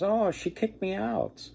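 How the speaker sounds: put-on young man lightly sobbing to the condor about his wife; mock crying. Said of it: "and he's crying".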